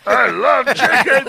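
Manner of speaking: high, slow goofy voice; silly high voice. Pretend. Playing the chicken guy, a slow silly high voice. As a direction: go high and dopey, dragging it out.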